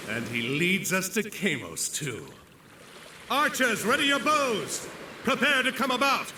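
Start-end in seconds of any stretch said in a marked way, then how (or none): 3.3-6.3 high, ringing commands over battle noise; rally the archers. Acted. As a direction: admiral shouting orders as enemy closes.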